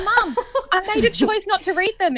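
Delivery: retold exasperated daughter's protest; exasperated pleading. Retells pleading with mum.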